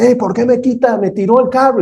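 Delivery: high, mock-angry shout at a driver; retold biker yelling. Biker yelling at the pastor from his motorbike, mock-angry shout. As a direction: act out the biker's angry outburst. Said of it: "empieza a gritarme y demás".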